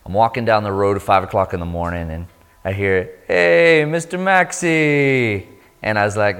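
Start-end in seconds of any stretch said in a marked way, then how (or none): 3.3-5.5 high, loud hail with a drawn-out name; calling out. Retold or imitated. Friend spots the traveler at dawn, calling out. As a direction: call down the road, stretching the name. Man calls to him down road.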